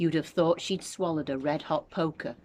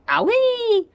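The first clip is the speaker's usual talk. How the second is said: very high, loud pained yelp; old woman's shrill cry of pain; yelled; 12 dB louder than usual. A narrator's reading. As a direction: shriek as if shocked. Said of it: "Grandma yelled".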